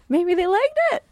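hopeful. Retold or imitated. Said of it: "and you're like".

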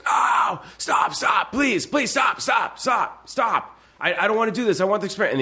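high, strained cries of pain begging to stop; pleading scream. Retold or imitated. Retelling the shocked man's desperate cries. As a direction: beg them to stop, voice cracking high. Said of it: "they hear the guy go".

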